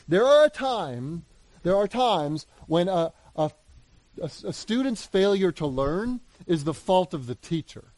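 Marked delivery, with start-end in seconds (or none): none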